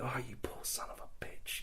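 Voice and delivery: very soft whispered sympathy; whispered pity. Retold or imitated. Onlooker reacting to the pleading captive, whispered pity. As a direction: whisper it under your breath, pitying.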